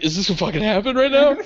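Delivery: psyched-up question to the mirror; excited disbelief. Retold or imitated. Guy psyching himself up in the bathroom mirror, excited.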